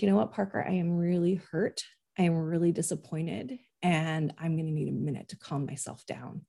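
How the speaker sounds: restrained, mildly hurt mom; subdued hurt. Retold or imitated. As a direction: hold the upset in, quietly. Retells telling son she is hurt.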